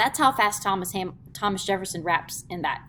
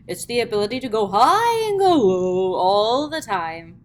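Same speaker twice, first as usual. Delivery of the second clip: exaggerated inflection; 3 semitones higher, 8 dB louder than usual. Shown as a demonstration. Teacher hamming up pitch swings to show inflection. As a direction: overdo the pitch swings like a cartoon. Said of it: "not quite that ridiculously".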